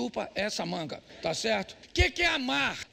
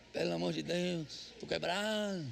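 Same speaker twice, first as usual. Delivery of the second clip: softer, slow weary moan; tired husband whine; 4 semitones lower, 6 dB quieter, slower than usual. Retold or imitated. Tired husband refusing wife's chores, softer weary groan.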